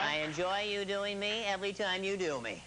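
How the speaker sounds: radio host impression, put-on timbre; Casey Kasem. Celebrity praising the man who impersonates him, imitated voice. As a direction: imitate the celebrity's measured voice throughout. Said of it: "Casey Kasem has sent me a gift every time I've done him".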